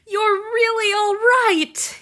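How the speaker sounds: high, loud, slow, tearful; crying with relief. Acted. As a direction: sob it out with joy and relief. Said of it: "he cried".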